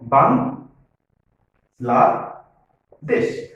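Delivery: বাংলাদেশ in three drawn-out syllables, pausing between each; teacher slowly splitting বাংলাদেশ into its three syllables. Shown as a demonstration. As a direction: say বাংলাদেশ very slowly as বাং, লা, দেশ. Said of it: "তিনবার থামতে হয়েছে".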